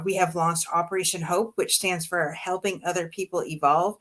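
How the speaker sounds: ordinary delivery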